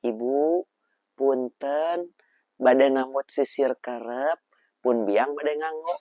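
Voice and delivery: slow, careful polite Sundanese; polite request. Shown as a demonstration. Child asking the neighbour politely, slow and careful. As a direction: say it slowly and respectfully. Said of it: "Kieu nyariosna".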